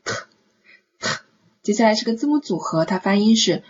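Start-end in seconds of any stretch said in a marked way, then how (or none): 0.0-1.1 very short T, just a burst of air; quick consonant. Shown as a demonstration. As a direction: say "t" quickly, isolated, with a pause before repeating. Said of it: "要快一些读". (短促轻快地读辅音t)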